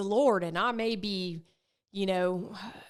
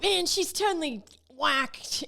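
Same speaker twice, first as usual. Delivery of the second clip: much higher skeptical listener voice; mock listener; 6 semitones higher than usual. A listener judging her, higher mocking voice. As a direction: sound like a doubting listener. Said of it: "that you're like".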